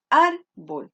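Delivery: "árbol" slowed, ÁR loud and long, bol falling away; stress on ÁR. Shown as a demonstration. Teacher tests where the voice falls, exaggerated ÁR. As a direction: lean hard on the ÁR of "árbol". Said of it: "se carga en ar".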